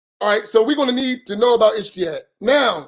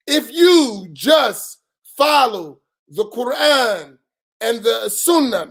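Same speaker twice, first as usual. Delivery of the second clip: slow and weighty; slower than usual. Shown as a demonstration. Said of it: "listen to my words closely".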